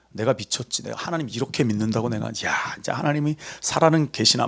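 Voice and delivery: low, soft mock grumble to oneself; imitated grumbling. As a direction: act out someone quietly griping about God. 무서운 원망을 혼잣말처럼 흉내. (낮게 투덜대는 혼잣말 흉내)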